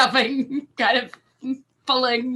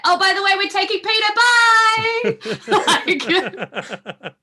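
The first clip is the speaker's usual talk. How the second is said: cheeky parting taunt; 12 semitones higher, 8 dB louder, slower than usual. Acted.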